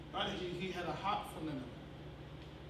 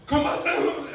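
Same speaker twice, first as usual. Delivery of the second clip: loud, raised challenge; mock haka shout; 7 semitones higher, 12 dB louder, slower than usual. Man about to go for his son, mock haka shout. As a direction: throw out a mock war cry. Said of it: "about to do the haka".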